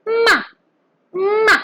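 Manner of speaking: MA starting high and dropping sharply; short falling "ma" from a high start; fourth tone. Shown as a demonstration. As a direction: throw "ma" down from high pitch. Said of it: "ma with the fourth tone".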